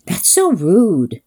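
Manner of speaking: slow, lightly voiced affront; drawn-out faux outrage; mock indignant surprise. Date shocked at being asked to leave, slow mock affront. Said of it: "had the nerve to be surprised".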